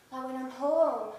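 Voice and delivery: usual speaking voice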